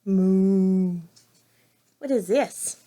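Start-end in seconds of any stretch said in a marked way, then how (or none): 0.0-1.0 long, low cow moo. Pretend. Reader answering what a cow says, imitated moo. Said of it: "What does a cow say?".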